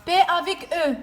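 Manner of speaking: put-on loud, high, slow sounding-out for a child; mother drilling her son on syllables, slow and loud; reading lesson. Shown as a demonstration. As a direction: spell it out like a patient teacher. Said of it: "mother sounds out syllables".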